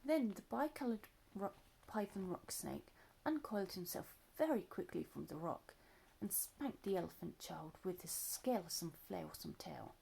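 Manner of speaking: plain reading voice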